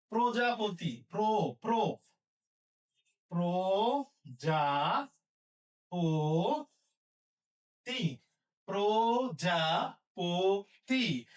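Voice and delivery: very slow, syllable by syllable; drawn-out dictation. Shown as a demonstration. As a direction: draw each part out for writing. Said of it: "teacher dictating syllables".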